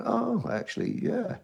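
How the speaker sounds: soft, high "oh" settling into quiet yeahs; imitated dawning approval. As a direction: act out being quietly won over, voice dropping. Imitating the band warming to him.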